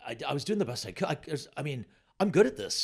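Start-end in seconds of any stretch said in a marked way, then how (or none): 2.2-2.8 high, loud, upset protest; comic nearly crying; near tears. Retold or imitated. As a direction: plead your case on the verge of tears. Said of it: "nearly in tears".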